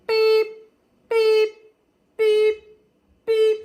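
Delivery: loud high beep, beep like a reversing truck; imitated backup beeps. Mimicking the tractor's beeping as it backs up, loud and high. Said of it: "I hear this noise".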